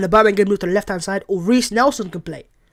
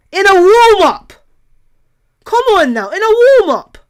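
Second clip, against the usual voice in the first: disbelieving shout; 12 semitones higher, 11 dB louder than usual. Real, not acted. Fan shouting in disbelief at a warm-up injury. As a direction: yell it out in disbelief.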